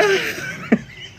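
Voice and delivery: a sudden high airy burst of laughter; high breathy laugh. Real, not acted. Laughing at her own slip on stream.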